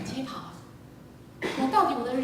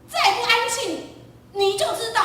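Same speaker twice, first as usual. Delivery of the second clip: much higher, louder warning; threatening parent; 12 semitones higher, 8 dB louder than usual. Retold or imitated. Parent threatening the quarrelling children, angry warning. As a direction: snap a threat at the kids.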